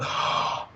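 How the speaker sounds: sharp, audible intake of breath; gasp of realization. Retold or imitated. All of a sudden feeling loved, an acted gasp.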